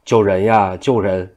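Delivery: plain reading voice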